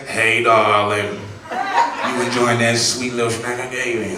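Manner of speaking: slow, low Southern drawl, imitating a coworker; a mock Southern belle offering a snack; Southern sweet talk. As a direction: coo it like a flirty Southern lady. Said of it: "her little southern ass".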